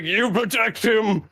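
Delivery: high, hammed-up tearful plea; weepy guardsman. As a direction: plead as an over-emotional protector. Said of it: "he's like".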